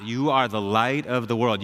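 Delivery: slow, measured correction; calm insistence. Retold or imitated. Jesus answering the doubting pastor, calm insistence. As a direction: repeat it slowly and unbudging. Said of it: "Jesus said".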